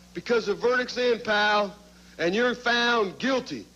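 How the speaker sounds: ordinary delivery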